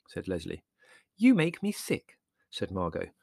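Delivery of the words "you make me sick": slow, higher, haughty put-down; dignified scorn. A narrator's reading. Margot dismissing Larry's joke with dignified scorn. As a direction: look down on him and say it slowly. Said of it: "with dignified scorn".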